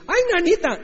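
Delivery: squealed high in fright; a comic terrified yelp; mock panic. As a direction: disciples yelping as they flee the arrest. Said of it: "todos dicen".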